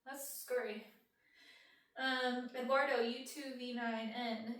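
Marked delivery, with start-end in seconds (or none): 0.0-0.8 retold ooh. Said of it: "I was like".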